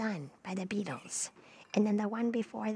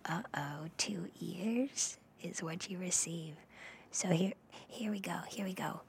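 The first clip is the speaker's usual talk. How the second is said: ASMR whisper; 3 semitones lower, 6 dB quieter than usual. Acted. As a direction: whisper it close to the mic.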